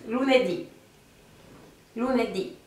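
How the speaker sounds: Lunedì with the stress on the closing DÌ; stress on final syllable. Shown as a demonstration. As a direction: land firmly on the last syllable of Lunedì. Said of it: "de la fin".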